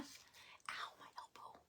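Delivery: hushed pained whisper; a real little ouch, kept off-mic; quick winced whisper of pain. Real, not acted. Bumps her elbow mid-stream.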